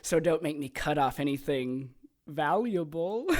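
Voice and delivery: usual speaking voice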